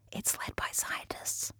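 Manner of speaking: slow breathy whisper. Shown as a demonstration. Corrects quote, then repeats it.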